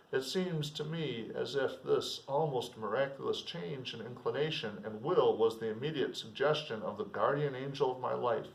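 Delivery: ordinary talk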